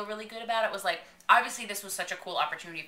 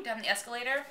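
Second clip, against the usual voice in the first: ordinary delivery, nothing marked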